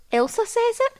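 put-on toddler voice. Mum voicing her toddler's reply.

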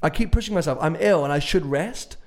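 usual speaking voice

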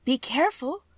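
put-on high-pitched girl's warning; crying out in warning. A narrator's reading. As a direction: call out high, as a girl. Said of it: "cried the green girl".

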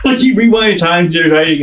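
ordinary talk

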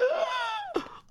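high mock-horrified gasp; shocked gasp. Guest retelling positive test to co-host, shocked gasp. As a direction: suck in breath sharply in shock.

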